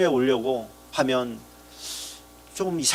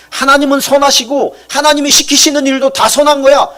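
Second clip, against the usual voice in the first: fervent proclamation; 12 semitones higher, 9 dB louder than usual. Real, not acted. Preacher proclaiming God is good.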